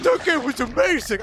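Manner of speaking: mock gushing fan. Friend imitating fans who rave about the game. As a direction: ham up an overexcited fan. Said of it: "when people are like".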